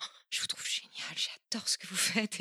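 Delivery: shy fan whisper. Retold or imitated. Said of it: "en me disant".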